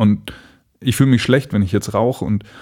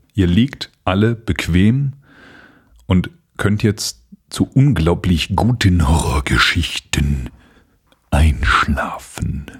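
spooky whisper; slower than usual. Pretend. Spooky bedtime announcement.

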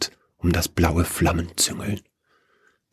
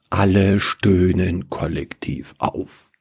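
ordinary delivery, nothing marked

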